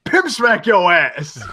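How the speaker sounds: high, drawn-out battle cry; slow hammed-up yell with a laugh; mock scream. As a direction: imitate a brute's screamed attack call. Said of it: "he just screams".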